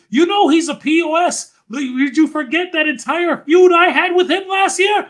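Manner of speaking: put-on high, loud French-accented outburst; mock French accent. Best friend scolding Sammy from home, high French-accented shouting. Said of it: "in his little French accent".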